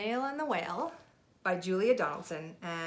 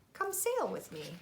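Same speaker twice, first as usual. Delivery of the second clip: gentle whale invite; 8 semitones higher, slower than usual. Acted. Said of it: "said to the snail".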